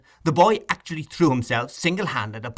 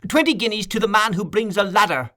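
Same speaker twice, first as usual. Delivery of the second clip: shouted offer; 8 semitones higher than usual. A narrator's reading. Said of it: "in a voice that rose above all others".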